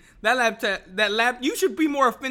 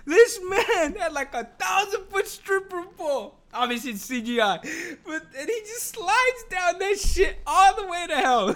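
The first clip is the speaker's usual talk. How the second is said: fighting the giggles through it; laughing; 11 semitones higher, 4 dB louder than usual. Real, not acted. Vlogger to viewers, crying with laughter. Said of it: "I started bursting out fucking laughing".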